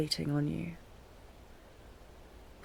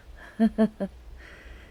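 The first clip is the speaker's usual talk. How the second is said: put-on a short, tired laugh at an old memory; wistful broken chuckle; weak fond laugh; 4 semitones higher, 4 dB louder than usual.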